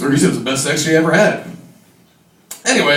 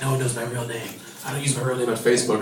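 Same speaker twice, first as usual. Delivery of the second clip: lowered hooker voice; 7 semitones lower, 10 dB quieter, faster than usual. Retold or imitated. Hooker answers him in story.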